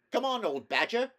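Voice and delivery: high, loud hearty call; shout. A narrator's reading. Rat shouting to the badger in the hedge, loud. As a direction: call him out across the hedge. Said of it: "shouted the rat".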